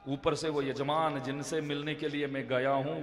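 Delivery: ordinary talk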